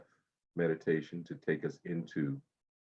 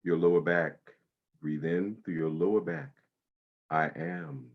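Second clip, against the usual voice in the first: guided meditation. Acted. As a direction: ease them into calm breathing. Guides body-scan meditation.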